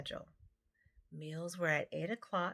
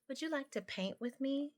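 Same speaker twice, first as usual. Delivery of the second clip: a small kitten asking, voice lifted high; lightly voiced eager little kitten; 8 semitones higher than usual. A narrator's reading. Narrator voices kitten Toulouse.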